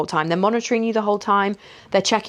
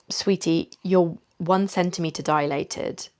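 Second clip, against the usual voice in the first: gentle, loving; 5 dB quieter, slower than usual. Retold or imitated. Midwife to patient after checking her, gently and lovingly. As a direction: imitate a kind midwife, softly. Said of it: "very, very nice, gentle, loving way".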